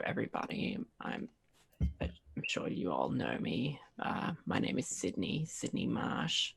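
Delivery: low whispered oily voice; slimy tax collector. Acted. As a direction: ooze it out like a greasy crook. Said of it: "he just looks like this, like, slimy dude".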